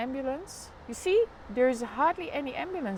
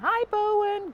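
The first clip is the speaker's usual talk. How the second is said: excited greeting; 11 semitones higher, 8 dB louder, slower than usual. Real, not acted. Greeting a child viewer.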